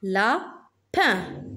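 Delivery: "Lapin" split into la, pin; syllable by syllable. Shown as a demonstration. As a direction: pause between "la" and "pin" in "Lapin". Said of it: "la syllabe qui se répète". (« Lapin » en deux syllabes bien détachées)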